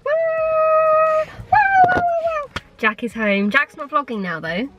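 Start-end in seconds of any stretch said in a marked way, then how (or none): 0.1-2.5 high, drawn-out celebratory woos; excited whooping. Real, not acted. Cheering a friend's return.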